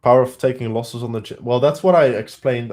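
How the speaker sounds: usual speaking voice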